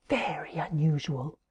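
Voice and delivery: whisper. A narrator's reading. Narrator building suspense.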